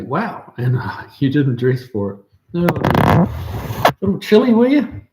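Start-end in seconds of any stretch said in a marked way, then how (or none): 2.5-5.0 slow, rising tease, pitch climbing high; playful ribbing, slow and high; teasing mock sympathy. Real, not acted. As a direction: needle him about the chilly weather. Teasing viewer about cold weather.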